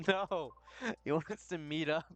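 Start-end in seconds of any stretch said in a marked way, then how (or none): 0.1-0.5 laughing no. Real, not acted. Laughing at own slip.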